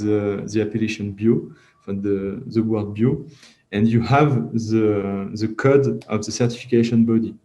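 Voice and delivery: careful, hesitant English in a French accent. Real, not acted. Trainer explaining certification codes to export webinar attendees, French-accented English. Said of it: "admirer mon superbe accent".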